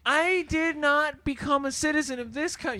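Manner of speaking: high mock-outraged citizen; high-pitched put-on affront; outraged complainer. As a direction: declaim with hammed-up indignation.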